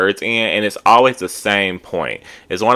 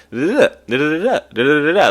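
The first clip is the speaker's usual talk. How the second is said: higher, bouncy da-da-da babble; mock chirpy chatter; 9 semitones higher, faster than usual. Vlogger mocking a cast member's chirpy nonstop talk, bouncy babble. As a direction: lightly mimic her jumpy interrupting chatter. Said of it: "chirpy and very, like, jumpy".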